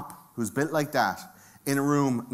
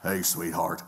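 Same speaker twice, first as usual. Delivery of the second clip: menacing prisoner; 7 semitones lower, slower than usual. Acted. Acts out menacing prisoner greeting cellmate.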